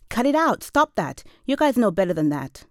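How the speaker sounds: sharp, high, loud telling-off; scolding shout. A narrator's reading. Narrator telling rowdy kids on the bridge off, scolding shout.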